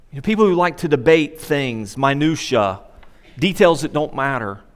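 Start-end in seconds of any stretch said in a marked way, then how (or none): none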